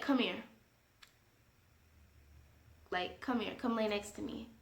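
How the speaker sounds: quiet, low imitation of a man coaxing; low-key, hushed mock invitation. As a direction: murmur a casual come-on, very softly. Voices the man coaxing her over.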